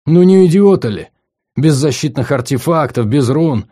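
exasperated outburst. Acted. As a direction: throw up your hands at fools. Narrator exasperated at the students. (раздражённый возглас)

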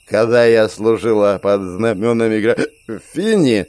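tongue tangled, slurring; slurred drunk speech. Acted. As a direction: slur like a drunk veteran. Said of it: "совсем заплетающимся языком".

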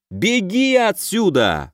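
high, drawn-out cry of command; urgent yell. A narrator's reading. Raid leader to a frozen elf in battle, urgent yell. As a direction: yell it slowly, pitched well up. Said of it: "в ухо замершему эльфу заорал Алёша".

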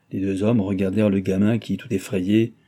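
usual speaking voice

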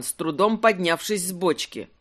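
usual speaking voice

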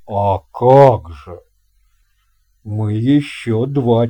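put-on a hearty old captain welcoming a guest; captain's voice. Captain explains. (басовитый голос капитана)

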